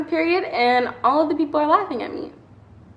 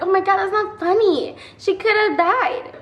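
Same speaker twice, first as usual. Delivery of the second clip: put-on higher, indignant girl voice; nice girl defending; 8 semitones higher, 3 dB louder than usual. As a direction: play the shocked classmate taking her side. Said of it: "She was like".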